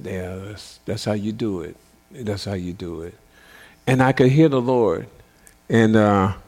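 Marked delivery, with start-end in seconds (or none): 0.0-3.2 much softer, murmured encouragement; hushed approval. Retold or imitated. Husband softly approving his wife's prayer, hushed. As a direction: murmur it low in agreement.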